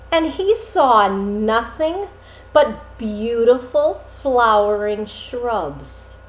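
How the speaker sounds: ordinary delivery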